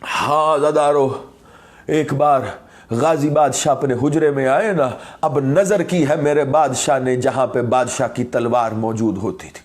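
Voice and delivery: subdued majlis lament; low grieving narration. Real, not acted. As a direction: tell it low like a broken mourner.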